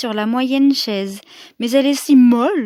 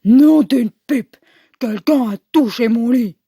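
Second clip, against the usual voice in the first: big grunting bear voice; 5 dB louder than usual. Acted. Papa Bear seeing his sheets pulled, big gruff voice. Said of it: "Il grogne de sa grosse voix".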